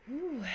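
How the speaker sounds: long exhaled whew, swooping up and back down; high, weary "phew"; relieved whew. Real, not acted. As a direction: let out the week in a big whew. Exhales over a hard week.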